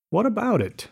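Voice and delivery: high, light polite question. A narrator's reading. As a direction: ask politely with a lifted pitch. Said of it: "said Gatsby politely".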